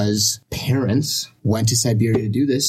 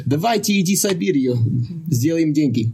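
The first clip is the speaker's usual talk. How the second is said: playful Russian line, pitched higher; spoken in Russian; 6 semitones higher than usual. Shown as a demonstration. Guest shows he can speak Russian. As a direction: pitch Siberia like a recruiter, in Russian. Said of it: "I can talk in Russian".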